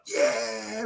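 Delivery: high excited cheer of a goal; mock goal celebration cry. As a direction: yell it like your team just scored.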